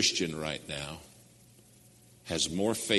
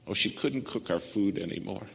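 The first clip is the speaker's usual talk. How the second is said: normal talking voice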